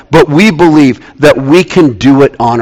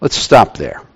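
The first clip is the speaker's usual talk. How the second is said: ordinary talk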